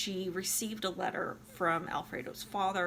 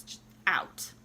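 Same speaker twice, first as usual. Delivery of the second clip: ordinary delivery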